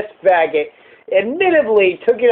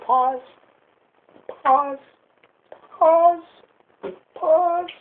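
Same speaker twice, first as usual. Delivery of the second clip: repeated high calls, climbing higher; high-pitched calling; 11 semitones higher, 4 dB quieter, much slower than usual. Pretend. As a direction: playfully pitch it way up, each call separate. Repeatedly calls pause in squeaky pitch.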